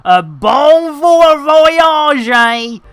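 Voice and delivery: high, hammed-up French farewell; mock French. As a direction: toss off a mock French goodbye. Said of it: "or whatever they say in South Africa".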